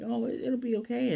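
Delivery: high, sing-song mock "it'll be okay"; mock reassurance. Vlogger mocking the family's calm, high and sing-song. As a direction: parody the family's carefree shrug, pitched high. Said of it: "Everyone's just kinda like".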